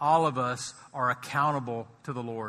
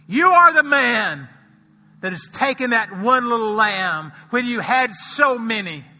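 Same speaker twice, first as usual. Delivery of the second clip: much higher and louder accusation; thundering accusation; 6 semitones higher, 10 dB louder than usual. Acted. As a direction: thunder it at the king. Prophet accusing the king.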